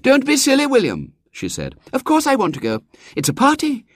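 put-on high, imperious girl's voice; a little girl giving orders; bossy little girl. A narrator's reading.

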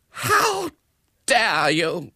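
very high, drawn-out indignation; shrieky affront; outraged retort. A narrator's reading. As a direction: explode with offended dignity. Accused hostess retorts in outrage.

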